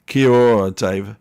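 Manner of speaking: "Kia ora" called out loud, first sounds stressed; loudly attempting "Kia ora" with effort; trying a Māori greeting. Shown as a demonstration. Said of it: "I can't even say it properly".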